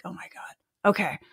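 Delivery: very soft, near-whispered retold amazement; hushed compliance. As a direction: give in under your breath. Retold giving in to dog.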